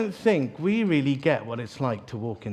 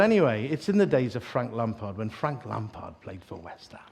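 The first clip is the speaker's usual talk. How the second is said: usual speaking voice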